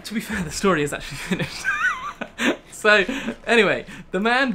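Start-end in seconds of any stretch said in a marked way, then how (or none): 0.0-1.6 loud, quick, breaking into laughter; laughing. Real, not acted. Streamer admitting to viewers the story is over, laughing. 1.7-2.6 loud high-pitched burst of laughter. Real, not acted. Streamer cracking up at own sad story, high laugh.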